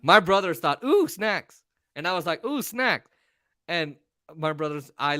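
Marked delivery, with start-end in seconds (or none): 0.8-1.6 high, swooping ooh of temptation; delighted cooing. Retold or imitated. Brothers delighted at seeing snacks. 2.4-3.1 high, swooping ooh of temptation; delighted cooing. Retold or imitated. Himself equally tempted by snacks.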